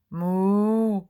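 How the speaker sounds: loud, lowing moo in a strange voice; loud cow moo. A narrator's reading. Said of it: "she says again loudly".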